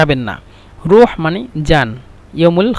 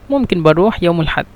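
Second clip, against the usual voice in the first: মুমকিন বা রুহ ইয়াউমুল হাদ blended quickly into one flowing phrase; run together fast; faster than usual. Shown as a demonstration. Teacher showing students the phrase said together, quick and linked. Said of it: "এভাবেই একসাথে বললে".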